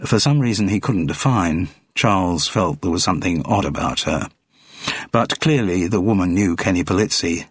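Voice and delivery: ordinary delivery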